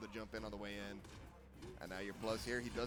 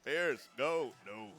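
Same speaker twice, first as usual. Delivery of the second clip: put-on louder old man voice calling out; 6 dB louder, much slower than usual. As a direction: play a doddering old man shouting commands. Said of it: "his old man voice going".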